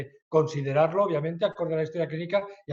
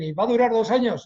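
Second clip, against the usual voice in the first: higher, louder quoted question; imitated questioner; 5 semitones higher, 6 dB louder, faster than usual. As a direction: lightly voice an eager questioner's query. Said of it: "Entonces, cuando dicen".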